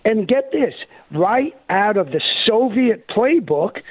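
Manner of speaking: usual speaking voice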